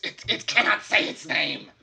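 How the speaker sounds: high, loud hissed goblin cry; hissing goblin. Acted. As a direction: hiss it like a goblin. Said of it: "the one closest to you says".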